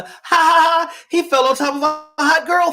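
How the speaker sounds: high-pitched mock laugh running into words. Podcaster ridiculing the film's hot girl gag, mocking and high.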